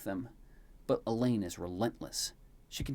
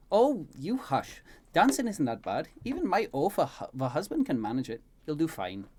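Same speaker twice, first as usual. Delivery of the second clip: higher, louder brisk reassurance; Elaine, lively scolding; 6 semitones higher, 7 dB louder than usual. Acted. Elaine to the sighing prince before the party, scolding reassurance. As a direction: brush off the worry in a raised character voice.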